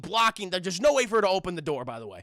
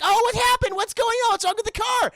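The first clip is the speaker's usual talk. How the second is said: much higher, louder panicked squeal; mock panicked girls; 13 semitones higher, 6 dB louder than usual. Mocking the heroines at the flat tire, high panicked squeal. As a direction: squeal it like helpless movie girls. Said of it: "they're just like".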